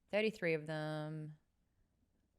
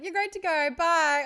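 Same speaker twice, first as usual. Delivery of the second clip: chipper pharmacist; 12 semitones higher, 14 dB louder than usual. Retold or imitated. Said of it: "This guy's like".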